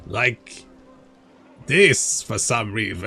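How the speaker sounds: lightly hammed-up evil Vlad, slow; mock menacing vampire lord, drawn out. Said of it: "it's vampire voice".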